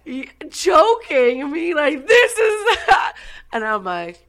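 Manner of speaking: words bursting through laughter; can't stop laughing while telling it. Real, not acted. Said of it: "I can't stop laughing".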